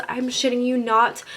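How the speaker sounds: usual speaking voice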